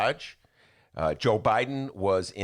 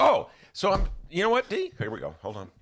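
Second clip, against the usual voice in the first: ordinary talk, nothing marked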